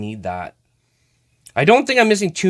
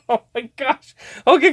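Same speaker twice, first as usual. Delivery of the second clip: ordinary delivery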